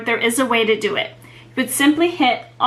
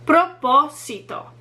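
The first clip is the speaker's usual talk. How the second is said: "Propósito" with PO stressed, falling after; stress on third-to-last syllable. Shown as a demonstration. Teacher modelling for repetition, stress on PO in "Propósito". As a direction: hit the second syllable of "Propósito". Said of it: "third to last syllable is the one that receives the stress".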